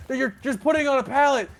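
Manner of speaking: high loud nagging yell; mock yelling. Coworker yelling from platform at driver, high loud mock yell. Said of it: "He's like".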